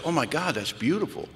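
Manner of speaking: soft, awed mock sponsor; hushed delight. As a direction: react quietly, as if deeply touched.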